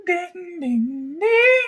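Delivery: high sung ding ding ding; chime imitation. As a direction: sing it like a chime rising. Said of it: "you hear the chime again".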